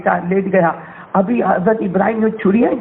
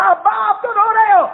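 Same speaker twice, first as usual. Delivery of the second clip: put-on high, startled little boy's voice; a child shocked to see his father cry; surprised child; 12 semitones higher than usual. Son sees father crying.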